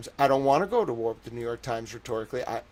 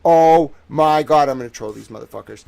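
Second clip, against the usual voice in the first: usual speaking voice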